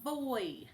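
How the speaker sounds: voy with bitten lip, buzzing v; voiced v into oy; blended sound. Shown as a demonstration. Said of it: "it says".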